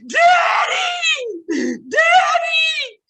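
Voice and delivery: high, loud wailing cry of Daddy; wailing shout. Acted. Random mourner bursts into the funeral wailing for her father, loud crying shout. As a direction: howl it out like a grieving stranger. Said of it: "just start hollering".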